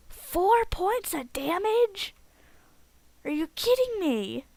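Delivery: loud, high-pitched incredulous outburst; excited disbelief. Real, not acted. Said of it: "Getting so excited over this battle".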